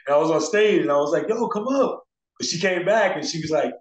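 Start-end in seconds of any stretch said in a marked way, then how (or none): 1.3-2.0 calling her up. Retold or imitated. Calling her onto stage.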